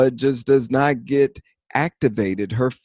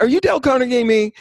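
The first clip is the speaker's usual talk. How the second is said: much higher, fast, teasing accusation; mock suspicious; 12 semitones higher, 4 dB louder, much faster than usual. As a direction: squeal it out like a playful callout. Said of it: "I'm like".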